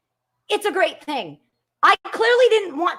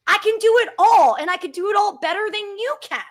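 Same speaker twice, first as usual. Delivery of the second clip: usual speaking voice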